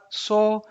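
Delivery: sou reduced to a pure O as in everyday speech; casual sou with one vowel. Shown as a demonstration. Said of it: "is what you're gonna hear".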